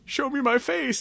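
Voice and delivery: a hammy villain commanding his shapeshifter. Retold or imitated. Sinister demanding Morph show his face, high and hammy. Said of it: "He goes to Morph".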